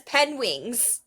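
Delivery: "penwings" for penguins, said as pen plus wings; mispronounced penguins. Retold or imitated. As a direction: imitate his botched "penwings". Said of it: "the whole time he says penwings".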